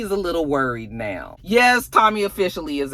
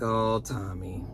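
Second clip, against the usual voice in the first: lower, slow, soft sigh of a name; swooning; 8 semitones lower, 7 dB quieter, slower than usual. Retold or imitated. Woman swooning over Tommy, lower, slow and soft. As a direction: melt as you say it, slowly. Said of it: "And I was like".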